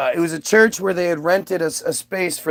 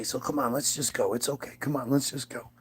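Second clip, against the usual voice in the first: soft, lower, hushed reassurance; gentle coaxing; 4 semitones lower, 11 dB quieter than usual. Retold or imitated. Dad to his upset kid at preschool, gentle coaxing. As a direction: coax a child quietly, keeping it low.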